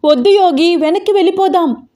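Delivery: high, alarmed pleading; frightened. A narrator's reading. Swapna begs the driver to turn back, frightened. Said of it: "Swapna భయపడిపోతోంది".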